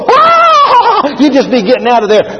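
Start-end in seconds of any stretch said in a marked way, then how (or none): 0.0-1.1 very high, drawn-out comic scream; hammed-up high shriek of panic; mock terrified scream. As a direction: scream as if fleeing a snake. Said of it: "You'd be going".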